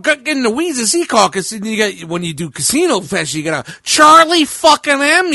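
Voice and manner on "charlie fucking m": high, loud, slow twang on Charlie fucking M; demoing the Pesci line, higher and louder. Shown as a demonstration. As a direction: twang it up a little bit. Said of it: "you gotta twang it up a little bit".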